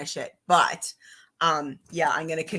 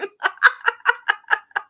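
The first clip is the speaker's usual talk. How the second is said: real burst of high laughter; surprised bright laugh; high giggle; 14 semitones higher, 5 dB louder than usual. Real, not acted. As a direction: giggle up high, caught off guard. Amused by listener comment.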